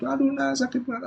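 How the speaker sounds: high, quiet moan of pain; mock an elderly parent's soft "ouch"; aching parent. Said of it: "pegelinu di mana-mana sakit". (orang tua mengeluh kesakitan)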